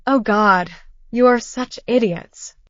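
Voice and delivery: plain reading voice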